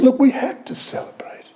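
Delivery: a hushed, whispered plea; pleading father. Acted. Father pleading with his angry elder son, whispered. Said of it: "He's pleading with him".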